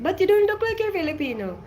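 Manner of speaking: high-pitched mock incredulity; imitated disbelief. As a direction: imitate a doubtful stranger, voice jumping up. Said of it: "they would be like".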